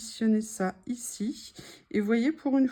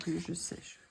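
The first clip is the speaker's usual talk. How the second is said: whispered self-reassurance; 4 semitones lower, 14 dB quieter than usual. Real, not acted. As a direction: breathe it out as a whisper. Whispering to herself thinking.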